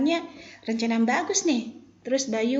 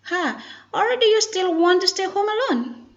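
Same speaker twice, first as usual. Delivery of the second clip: high, louder questioning read; model intonation reading; 10 semitones higher, 6 dB louder than usual. Shown as a demonstration. Teacher models Bayu's question to students, high and emphatic. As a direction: lift the whole question for learners. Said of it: "focus to pronunciation and intonation".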